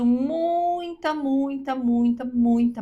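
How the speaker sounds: "muita" drawn out long, high, stepping down each time; stress on muita. Real, not acted. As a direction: stretch every "muita" in one sustained emphatic run. Drawn-out repetition for emphasis. ("muita" bem arrastado e enfático, começando agudo)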